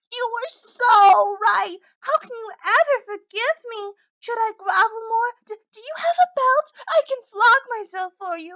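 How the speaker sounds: fake-weep and grovel; hammed-up weepy groveling; mock sobbing. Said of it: "You might be trying to mock me".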